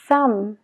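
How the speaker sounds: "thumb" said as tham, no b; silent b in thumb. Shown as a demonstration. Teacher models "thumb" with the silent b. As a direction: close "thumb" on the m. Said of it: "no se pronuncia la B".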